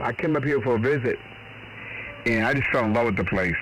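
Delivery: ordinary talk, nothing marked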